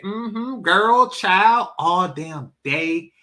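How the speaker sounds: high, loud caricature of Southern women; mock Southern accent. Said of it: "they just sound like".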